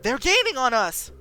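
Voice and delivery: high, loud alarmed cry; panicked warning. Acted. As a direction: warn them they're closing in.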